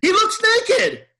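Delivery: loud, squeaky-high comic outburst; high-pitched exclamation. Real, not acted. Echoing the co-host about Chewbacca, high comic outcry. Loud echo of co-host's punchline.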